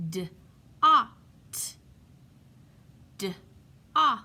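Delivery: "D-ot" split into d and ot, loud; sounding out words. Shown as a demonstration. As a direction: say "D-ot" as two separate sounds. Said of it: "blend the sounds".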